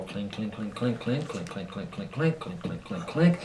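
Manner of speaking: soft, rhythmic string of clinks; imitating cash register. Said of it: "the cash register is gonna go".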